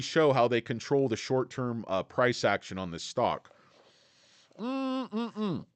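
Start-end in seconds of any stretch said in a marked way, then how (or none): 4.6-5.7 savoring hum. Real, not acted. Savoring a sip of tap water.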